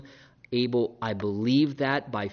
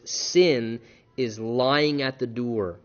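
slow emphasis; 3 semitones lower, much slower than usual. Shown as a demonstration. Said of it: "then he says".

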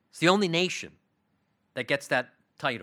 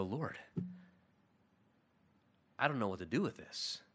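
soft, much quieter plea; hushed helpless prayer; 3 semitones lower, 10 dB quieter than usual. Retold or imitated. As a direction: murmur it to God like you're at a loss. Believer voiced praying in distress.

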